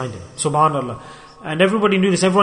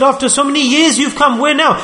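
high-pitched exasperated wife; wife's mock complaint; 8 semitones higher than usual. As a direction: retell her protest in a raised pitch.

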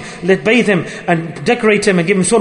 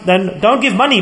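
normal talking voice

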